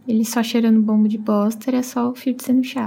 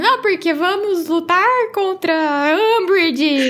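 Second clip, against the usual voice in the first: higher, drawn-out mock yell; mock bellowing; 6 semitones higher, slower than usual. Students plotting against Umbridge in the bar, mock bellowing. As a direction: imitate rowdy yelling without much real volume. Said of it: "tipo, berrando".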